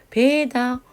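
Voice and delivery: 배다 said slowly with held first syllable; demonstrated pronunciation. Shown as a demonstration. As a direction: draw out the 배 in 배다. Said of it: "얘는 축약".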